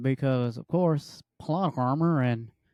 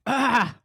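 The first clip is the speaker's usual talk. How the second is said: mock scream; 8 semitones higher than usual. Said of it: "Brendan Fraser is like".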